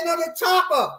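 high, insisting repeat; mock insistent correction; high insistent quote. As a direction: squeak it out insistently. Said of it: "He said".

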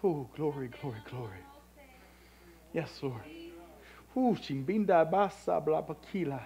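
low, soft reverent praise with long pauses; hushed prayerful preaching. Real, not acted. Preacher murmuring glory to the Lord after the climax, hushed prayerful preaching. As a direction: speak low and halting, as if awed in prayer.

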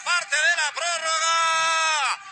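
loud, fervent radio goal recap; shouted goal commentary. Real, not acted. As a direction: roar the goal story to the fans.

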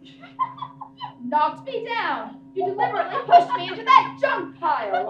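loud, indignant accusation; furious after the fall; outraged shout. Real, not acted. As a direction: hurl the blame at him. Knocked down, outraged.

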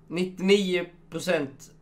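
ordinary delivery

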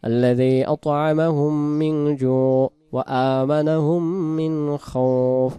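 slow chanted recitation with full throat sounds; correct recitation. Shown as a demonstration. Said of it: "এভাবে পড়ি". (সঠিক ধীর তিলাওয়াত)

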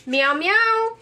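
high, sing-song meow; imitated cat meow. Prompting the toy cat to answer, imitated meow.

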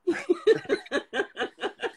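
high-pitched burst of laughter. Real, not acted. Sister admits she was like that, laughing high.

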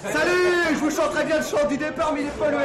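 high, hammed-up cheery farewell, mocking his captors; mock cheerful bravado. Arrested militant taunting the police, hammed-up cheerfulness.

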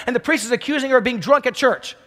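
ordinary delivery

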